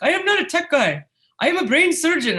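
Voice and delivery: high, loud offended correction; indignant guy voice. Retold or imitated. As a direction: put on an offended man's voice. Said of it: "this one guy comes up and he's like".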